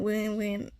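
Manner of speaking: ordinary talk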